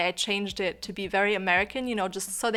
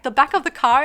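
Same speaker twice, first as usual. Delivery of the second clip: high, loud questioning retort; insistent explanation; 5 semitones higher, 10 dB louder than usual. Retold or imitated. Explaining the boot to her host mom, loudly insistent. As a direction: explain it louder, as if it were obvious.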